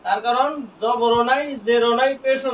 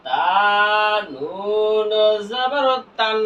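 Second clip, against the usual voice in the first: slow, with long held তা and নুন; teacher's slow spelling drill; drawn-out spelling recitation; much slower than usual. Shown as a demonstration. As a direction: spell it out, stretching each letter name. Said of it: "কি বানান করব আমরা?".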